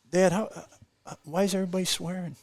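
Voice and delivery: put-on high-pitched, hesitant kid's question; imitated young son. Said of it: "and he's like, uh, he goes".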